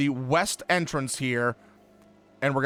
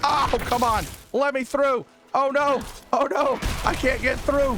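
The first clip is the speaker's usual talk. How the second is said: frustrated panic; 8 semitones higher than usual. Real, not acted. Stuck in a crowd in game.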